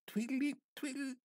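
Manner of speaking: high, loud squeaky tweedle; a sudden jump to a high electronic warble; imitated radio signal. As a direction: chirp it like a faint radio signal. Imitating faint satellite radio signal.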